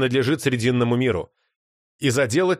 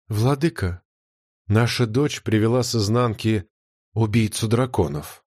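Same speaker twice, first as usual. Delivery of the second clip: lower, lifeless and distant; lifeless alien voice; 4 semitones lower than usual. A narrator's reading. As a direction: speak like something inhuman. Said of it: "абсолютно чуждым голосом".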